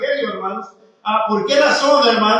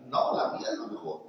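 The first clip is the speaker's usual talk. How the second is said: whispered boast; 14 dB quieter than usual. Retold or imitated. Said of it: "va a decir".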